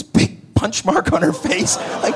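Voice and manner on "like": ordinary delivery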